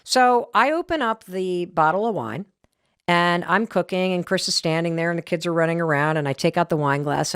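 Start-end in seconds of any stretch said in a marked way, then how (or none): none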